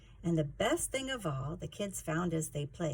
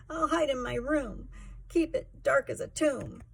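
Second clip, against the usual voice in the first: put-on higher, tearful child on the edge of crying; sniffling, tearful; 9 semitones higher, 3 dB louder than usual. A narrator's reading. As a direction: whimper it out, near tears, higher. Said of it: "sniffled Boggin".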